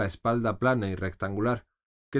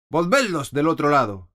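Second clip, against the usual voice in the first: loud, higher curt command; angry order; 5 semitones higher, 7 dB louder than usual. A narrator's reading. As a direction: bark the order in anger. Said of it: "enojada y ordenó". (orden enojada, alta y fuerte)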